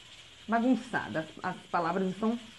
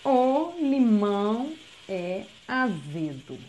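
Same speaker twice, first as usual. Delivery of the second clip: slow dictation; 4 dB louder, much slower than usual. Shown as a demonstration. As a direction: read very slowly, word by word for a child. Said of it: "ó".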